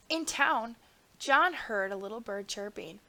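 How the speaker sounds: ordinary delivery, nothing marked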